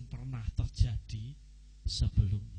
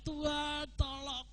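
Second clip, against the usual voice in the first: mock cry for help; 11 semitones higher, 10 dB quieter, slower than usual. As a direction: stretch it out as a desperate plea. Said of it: "Dulu saudara teriak". (seruan minta tolong yang tinggi dan lambat, ditirukan)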